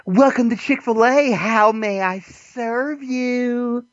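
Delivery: high, slow, overly polite drive-thru greeting; imitating polite cashier. Mocking a Chick-fil-A cashier at the drive-thru, high and slow. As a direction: stretch it out like a too-courteous clerk. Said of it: "they're like".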